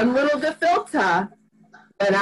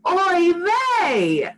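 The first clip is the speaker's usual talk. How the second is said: modeled catchphrase; 6 semitones higher than usual. Shown as a demonstration. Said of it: "we can say".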